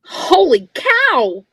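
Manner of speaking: loud, slow shocked blurt; startled exclamation. Acted. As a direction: gasp it out as the foot appears. Seeing a foot under sheets.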